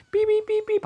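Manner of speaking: high, loud, level electronic beeps; imitated microwave beeping. Teasing about a microwave by the microphone, loud high beep imitation. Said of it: "you're like".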